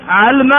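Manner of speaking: علم as 'alma; lightened middle. Shown as a demonstration. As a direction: say علم with a and vowelless l. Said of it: "علم فيه ثلاث لغات". (علم بفتح العين وتسكين اللام)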